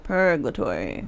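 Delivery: "Purgatory" slow, low and soft, with an L after the G; deliberate mispronunciation. Shown as a demonstration. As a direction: draw out "Purgatory" as PUR-gluh-tory. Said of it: "I keep saying it like that".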